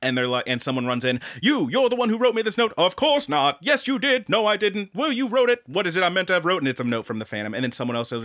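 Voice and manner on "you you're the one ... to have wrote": lightly voiced high bickering singers; a hint of operatic quarrel; mock stage argument, a bit higher; acted quarrel. Acts out the musical's argument.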